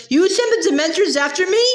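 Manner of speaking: high, stunned disbelief; shocked and accusing; shocked outrage. A narrator's reading.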